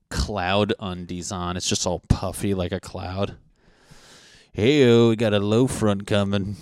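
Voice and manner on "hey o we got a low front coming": loud, booming TV forecaster; weatherman voice. Retold or imitated. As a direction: belt it like a cheesy weatherman.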